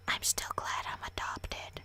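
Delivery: sour, slow whisper; bitter whisper. Acted. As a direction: whisper it spitefully.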